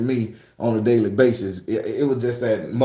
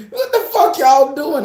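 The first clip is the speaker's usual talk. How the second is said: high, loud laughing disbelief, much higher; shocked outcry; 12 semitones higher, 6 dB louder than usual. Retold or imitated. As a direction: yelp it in total shock. Held down by siblings.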